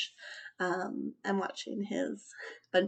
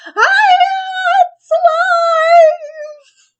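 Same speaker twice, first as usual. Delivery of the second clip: very slow, very high, loud scream; drawn-out terrified shriek; 20 dB louder, much slower than usual. Acted. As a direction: scream in horror. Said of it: "The man screamed".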